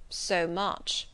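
solemn gentleman, mildly doubtful; a touch of doubt in a stern man's voice. A narrator's reading. Stranger hearing the girl's age, mildly doubtful. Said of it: "was the doubtful answer".

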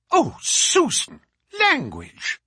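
high, drawn-out shocked scolding; shocked reproof. Acted. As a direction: scold the servant in scandalised tones. Mistress scolds servant for swearing.

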